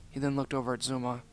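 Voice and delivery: plain reading voice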